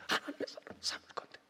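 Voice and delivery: whispered devotion; hushed vow. Acted. Preacher voicing Hannah, whispered vow. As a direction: breathe it as a holy secret.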